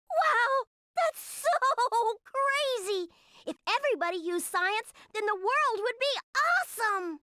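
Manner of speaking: high, slow, hammed-up gushing kid voice; mocking childish glee. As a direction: ham up a dumb kid's amazement. Kokonoi parodies Tao again.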